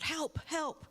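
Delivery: a light acted shout for help from the water; mock cry for help. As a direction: call out for help, half-acted. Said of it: "you hear someone yell out".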